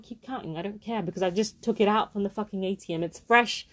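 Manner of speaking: ordinary talk, nothing marked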